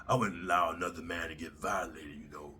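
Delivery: low, soft, lightly voiced tough guy; a hint of a macho commenter; mock commenter. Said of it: "you guys in the comments be like".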